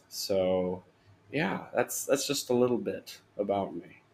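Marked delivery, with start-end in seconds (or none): none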